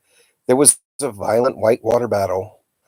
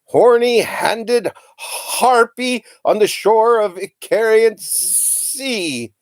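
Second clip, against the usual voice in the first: much higher, slow wild ranting; raving cry; 12 semitones higher, much slower than usual. A narrator's reading. Uncle rages at his reflection, high slow rant. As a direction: curse the mirror like an epic hero. Mad uncle raging at mirror.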